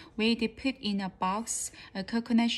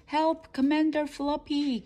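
higher cry for help; cried out; 6 semitones higher, 4 dB louder than usual. A narrator's reading. Crew member begs commander for help, higher cry. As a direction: call up urgently to the commander. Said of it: "cried one of the crew".

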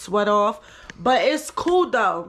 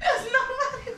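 laughing; 11 semitones higher, faster than usual. Real, not acted. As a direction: say it while still cracking up. Still laughing about the prank.

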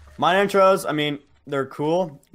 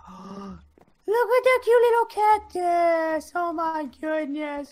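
cutesy cooing; slower than usual. Real, not acted. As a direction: coo over something adorable. Sees a cute cactus.

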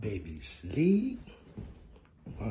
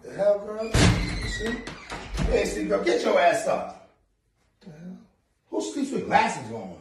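mock scolding; 9 semitones higher, 6 dB louder, slower than usual. Waking girlfriend up.

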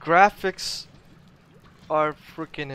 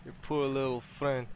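put-on Russian-accented English. Gamer narrating his play in a put-on Russian accent. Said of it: "hard for me to do it Russian accent".

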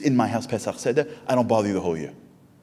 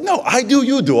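high, loud mock-modest insistence; humble commando; 14 semitones higher, 8 dB louder than usual. The commando deflecting praise in a high mock-humble voice. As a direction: insist you're the hero, not me.

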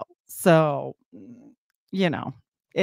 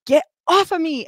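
much higher and louder, yelling get off; retold yell; 11 semitones higher, 6 dB louder than usual. Pinned by the ski instructor, yelling at him. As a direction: yell at the instructor on top of you. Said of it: "And I'm like".

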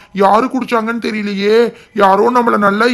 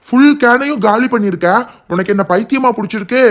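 ordinary talk, nothing marked

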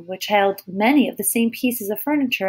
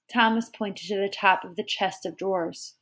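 plain reading voice

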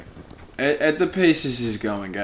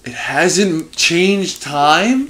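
disbelief; 6 semitones higher, 6 dB louder, much slower than usual. Real, not acted. Disbelief at game clock.

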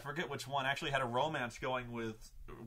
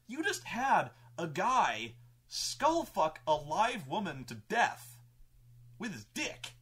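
slow, higher, imitating appalled players; disturbed disbelief; 5 semitones higher, slower than usual. Players to game master after a shocking scene, mock-appalled. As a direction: spell out the outrage slowly, higher pitched. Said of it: "the party was really disturbed by this".

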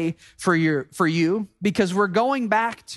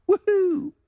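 mock cheer; 6 semitones higher than usual. Mock cheer after rote verse.

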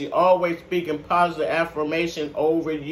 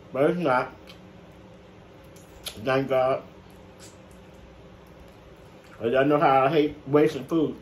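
chewing while talking; speaking between bites; mouth full; 3 semitones lower than usual. Real, not acted. Seen 0: bites and chews chicken pieces while speaking throughout the labelled words.

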